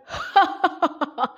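villain's high, rolling laugh; long staccato ha-ha-ha; hearty guffaw. A narrator's reading. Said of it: "ایک زوردار قہقہ سنائی دیا".

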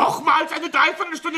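high, loud indignant cry; outraged shriek at yet another delay; outraged disbelief. Acted.

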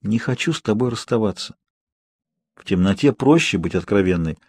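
ordinary delivery, nothing marked

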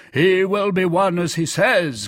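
emphatic teasing cry; cried out. A narrator's reading. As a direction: proclaim it to the whole company. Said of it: "cried Porthos".

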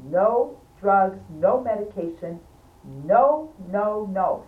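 loud, stubborn refusal of medication; retold loud refusal. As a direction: shout down the offer of drugs. Refusing drugs in the ambulance.

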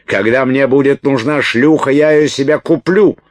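high, loud furious tirade; offended screaming rant. A narrator's reading. Уилт hurls insults back at Салли, furious screaming. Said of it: "завопил Уилт, разобиженный предположением".

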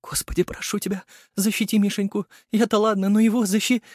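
desperate high pleading prayer, breaking off; frightened prayer. A narrator's reading. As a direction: pray in panic, cut off mid word. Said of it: "осеклась на полуслове".

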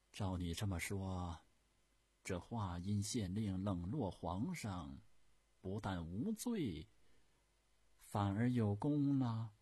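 sceptical Empress. Acted. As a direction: draw the words out, pressing on the last phrase. Empress questions the eunuch. (太后拖长声音反问)

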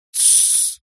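sharp voiceless creature hiss; angry shushing hiss. A narrator's reading. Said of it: "сердито шикнула на него кукла". (сердитое шиканье)

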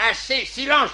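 commanding. Acted. Adjudant silencing a recruit.

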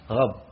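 "غب" for rabb, gargled gh in place of r; lisped rabb, starting with a throaty gh as "غب"; ra turned to ghayn. Shown as a demonstration. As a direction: say "غب" with ghayn where rabb has ra. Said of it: "ينطقها بالغين".

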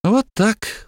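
pleased and smug, high; satisfied smirk. A narrator's reading. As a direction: say it with a satisfied grunt. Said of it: "удовлетворённо хмыкнул Фнул".

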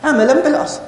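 teacher stressing the key point عملًا بالأصل; emphatic answer. Shown as a demonstration. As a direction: answer your own question in a lifted, high emphatic voice. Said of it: "عملًا بماذا؟".

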